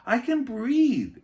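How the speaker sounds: high, loud, slow happy shout; excited yell. A narrator's reading. Annie yells inside her helmet that she can breathe. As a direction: yell it with relief and joy. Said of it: "Annie yelled".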